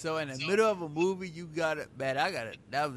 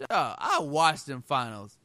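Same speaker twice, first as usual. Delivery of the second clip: loud, high, insistent; emphatic rebuttal; 3 semitones higher, 8 dB louder than usual. Real, not acted. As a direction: raise your voice in disbelief.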